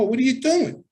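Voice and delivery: high-pitched indignant question; retold startled protest. Acted protest in a retold fight.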